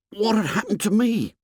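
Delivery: usual speaking voice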